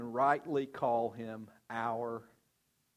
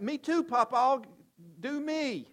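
put-on much higher, eager child's voice; little granddaughter; 9 semitones higher, 4 dB louder than usual. Three-year-old Addie begging her grandfather for a hug, high voice. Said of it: "she's like".

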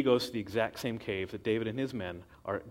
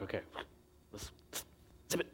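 hushed whisper; 4 semitones lower, 15 dB quieter, much faster than usual. Acted. Men hiding in the cave.

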